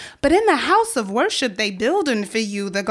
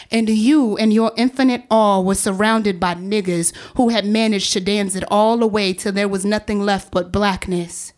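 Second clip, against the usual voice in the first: ordinary talk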